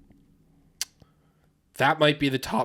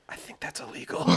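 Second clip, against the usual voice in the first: hushed producer quote; 11 dB quieter than usual. Retold or imitated.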